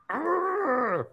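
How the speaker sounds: high drawn-out playful creature noise; high, sustained giddy growl; excited squeal noise. Pretend. Imagining a huge griffin.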